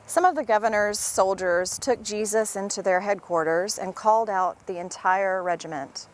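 usual speaking voice

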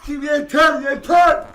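loud high gloating cheer; jubilant shout. Real, not acted. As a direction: gloat at full volume. Celebrating goal.

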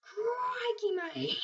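shouted Australian Crikey, high and loud; excited kid yelling Australian Crikey mate; Steve Irwin impression. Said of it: "I'd be like".

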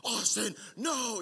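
high, strained mock yell; Batman. Dad yelling at his son, sounding like a superhero. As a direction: call it out like an overdramatic superhero. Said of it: "it sounded like Batman".

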